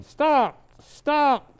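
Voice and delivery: high, loud desperate yell; yelling stop. A narrator's reading. Grandfather stuck in the tree yells at the kids, a high loud yell. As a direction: shout it to stop the racket. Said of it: "He was yelling".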